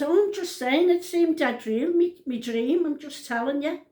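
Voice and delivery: high-pitched, defensive retort; acted protest. Nan answers her daughter's shut up, high-pitched protest.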